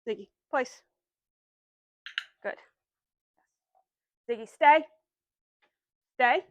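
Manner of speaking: loud, firm stay; loud stay command to the dog. Real, not acted. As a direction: hold the dog in place firmly.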